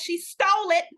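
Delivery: ordinary talk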